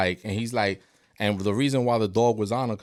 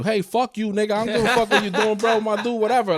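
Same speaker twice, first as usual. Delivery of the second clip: higher, cocky dog's voice; dog talking back; 10 semitones higher than usual. Retold or imitated. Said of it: "that dog is telling you".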